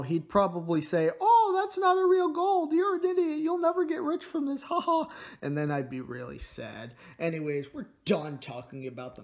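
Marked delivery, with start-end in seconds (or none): 1.2-5.1 very high, taunting appraiser voice; hammed-up mockery with a fake laugh; mocking appraiser. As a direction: sneer in a high put-on voice. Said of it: "if I brought this to an appraiser".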